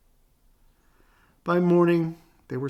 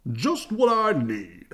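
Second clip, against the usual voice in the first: Papa Bear; 6 semitones higher, 5 dB louder, slower than usual. Acted. Papa's gruff voice.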